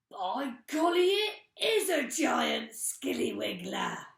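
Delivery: put-on loud, slow old man exclaiming; mock alarm. Mister Twit faking alarm to his wife. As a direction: ham up the fright.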